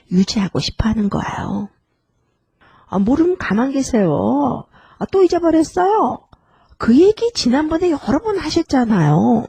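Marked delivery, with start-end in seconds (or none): 2.9-9.4 exasperated, higher-pitched complaining; mock negative retorts. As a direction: act out impatience with a forgetful parent. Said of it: "이런 네거티브한 표현들은". (짜증 섞인 높은 톤으로 핀잔주듯)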